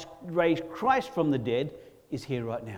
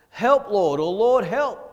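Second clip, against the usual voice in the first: loud, high acted plea; preacher's raised, urgent call for help; cry for help; 6 semitones higher, 9 dB louder, slower than usual. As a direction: cry out to God from trouble. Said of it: "a cry of help".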